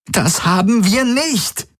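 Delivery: hoher, gedehnter Protest; frustriert. A narrator's reading. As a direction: frustriert abstreiten, jedes Wort betont. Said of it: "Kirai schlug frustriert gegen die Wand". (frustriert protestierend)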